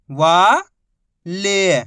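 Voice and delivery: each syllable held, long pause between; first syllable rising, then a separate second. Shown as a demonstration. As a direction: sound it out for beginning readers. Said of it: "एक ही अक्षर का उच्चारण करके".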